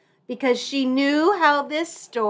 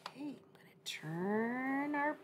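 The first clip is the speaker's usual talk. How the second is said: whispering; 3 semitones higher, 11 dB quieter than usual. Real, not acted. Aside to viewers while reading.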